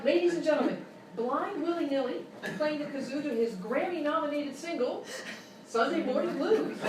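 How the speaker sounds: grand on-air introduction; announcer. Acted. Radio host introducing the kazoo player. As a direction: present the guest like a radio host.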